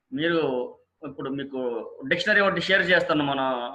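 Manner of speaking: ordinary talk, nothing marked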